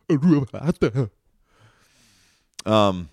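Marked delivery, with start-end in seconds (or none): 0.1-1.1 "Ulavata" cried out much higher and louder, slowly; booming solemn call of "Ulavata"; grand proclamation. Pretend. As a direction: proclaim "Ulavata" like a herald of the gods. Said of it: "Tolkien silence".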